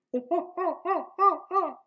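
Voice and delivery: high, repeated monkey hoots; monkey hooting. Acted. Reader proves he is a monkey, high hooting. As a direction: hoot like an excited monkey. Monkey noises after claiming monkey.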